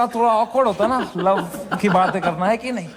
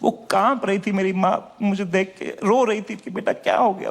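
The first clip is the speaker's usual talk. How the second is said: normal talking voice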